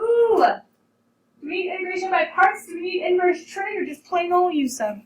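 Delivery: high, loud and quick with eager questions; bursting with excitement, rattling off options; excited. Real, not acted. Excited about choosing method.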